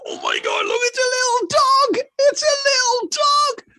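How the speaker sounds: high, far-off excited shout; excited guard voice. Acted. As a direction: squeal it out, very high. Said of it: "You can hear two guys go".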